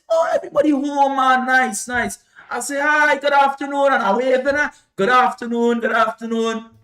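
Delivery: loud, high cheerful greeting at the window; calling out. Retold or imitated. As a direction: wave and call it into the house. Greeting family through window.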